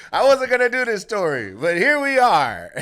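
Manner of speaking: loud and high, still laughing. Real, not acted. Comic admitting he is doing the story, laughing.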